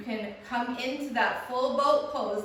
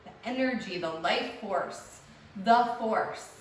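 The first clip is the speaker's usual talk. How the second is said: guided meditation. Acted. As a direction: ease them into stillness. Guided relaxation lying down.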